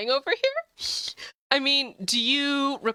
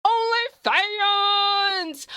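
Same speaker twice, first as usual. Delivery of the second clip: slow, drawn-out high shout; hammed-up announcer shout; announcer callout; 9 semitones higher, much slower than usual. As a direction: belt it out like a bot command. Mock chat command callout.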